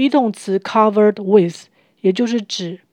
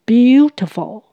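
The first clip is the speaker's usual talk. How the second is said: Beautiful with the BEAU stretched and lifted; long first syllable; 3 semitones higher, 3 dB louder, slower than usual. Shown as a demonstration. Teacher showing learners an expressive Beautiful, BEAU stretched. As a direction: drag out BEAU with feeling. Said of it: "我们可以把第一音节拉长".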